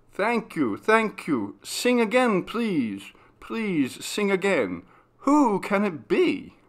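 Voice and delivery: high excited begging voice; eager encore plea. A narrator's reading. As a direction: beg the singer to go on.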